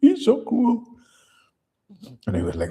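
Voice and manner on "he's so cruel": very high, loud falsetto complaint; mock complaining girl. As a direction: imitate a girl's hammed-up outcry. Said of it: "It's like the girl".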